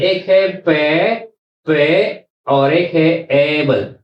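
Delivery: पे and एबल said slowly, apart; पे ... एबल, each part drawn out; split into syllables. Shown as a demonstration. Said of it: "तो ये दो आवाजें हैं". (शब्दांश अलग-अलग, धीरे)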